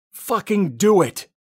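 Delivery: loud, high drawn-out scream; high-pitched, full-force shout; desperate yell. A narrator's reading. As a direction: yell it out slowly at the top of your voice. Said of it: "I yell".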